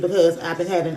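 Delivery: ordinary delivery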